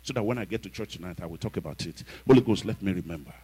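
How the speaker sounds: soft private prayer under the breath; muttered prayer. Retold or imitated. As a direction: pray quietly to yourself. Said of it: "he was saying".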